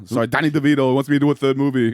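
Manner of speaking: ordinary talk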